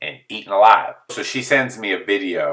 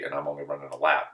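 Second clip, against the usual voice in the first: usual speaking voice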